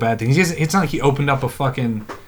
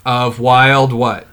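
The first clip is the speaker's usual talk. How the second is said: drawn-out, slow teasing question; slow prompting; 5 dB louder, much slower than usual. Real, not acted. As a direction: stretch it out to make him finish. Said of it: "Finish this sentence".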